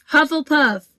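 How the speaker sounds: the Hat cries out the house; shouted. A narrator's reading. Sorting Hat announces to the hall, a high loud shout. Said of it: "the Hat shouted out the house".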